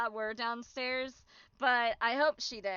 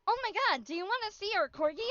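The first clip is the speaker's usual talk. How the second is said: high eager gushing offer; excited offer; 8 semitones higher than usual. Retold or imitated. Strangers at the booth eagerly offering their corgi. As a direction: gush about the corgi.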